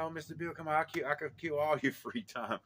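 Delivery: high, eager child impression; imitated little boy. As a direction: pester like a small kid wanting to play. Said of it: "He said".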